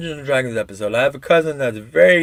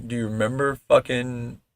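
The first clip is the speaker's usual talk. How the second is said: ordinary delivery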